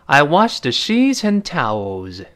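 I washed the sheets and towels read slowly; 慢速示范整句; slow model sentence. Shown as a demonstration. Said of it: "第二人就说". (放慢示范 I washed the sheets and towels)

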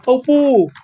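cried out. Acted. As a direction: cry out in storybook alarm. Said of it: "艾博尔叫道".

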